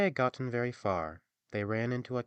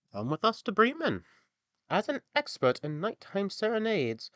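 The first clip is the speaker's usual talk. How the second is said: higher, louder inviting donkey; 11 semitones higher, 5 dB louder than usual. Acted. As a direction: coax the cat as the donkey.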